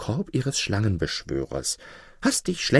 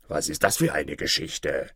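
much lower wolf voice, wary; gruff wolf; 8 semitones lower, 3 dB quieter than usual. Acted. Wolf in the pit questioning the fox, low and wary. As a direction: ask it low like a suspicious wolf.

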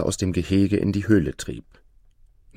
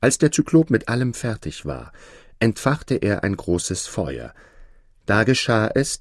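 ordinary delivery, nothing marked

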